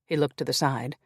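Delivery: plain reading voice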